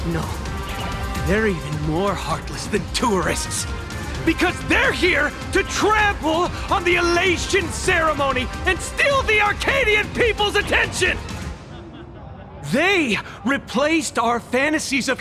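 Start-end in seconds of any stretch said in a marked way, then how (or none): 4.3-11.2 high, heated denunciation; rising, impassioned tirade; indignant accusation. Acted. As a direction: accuse them, voice climbing high. Acted accusation of the Express.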